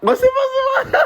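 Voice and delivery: high laughing through words. Real, not acted. Laughs at friend.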